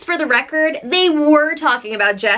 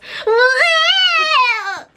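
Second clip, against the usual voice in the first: high strained cry for Paul's frustration; mock anguished wail; 12 semitones higher than usual. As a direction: wail like his plan just died. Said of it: "in Paul's head, he goes".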